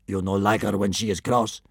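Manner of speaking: menacing sidekick; second kidnapper voice. A narrator's reading.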